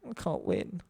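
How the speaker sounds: low, slow, quiet resignation; retold defeat. Recalling failing to get the cards back, quietly defeated. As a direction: sink down softly and give up.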